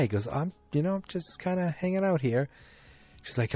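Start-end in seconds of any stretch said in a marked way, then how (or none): 0.3-2.5 pitched higher, lightly voiced laid-back guy; a hint of a breezy junkie husband; casual husband voice. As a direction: toss it off like a drifter. Voicing the artist's husband.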